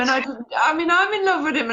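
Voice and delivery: giggling confession; laughing. Real, not acted. Teasing about falling for the poet.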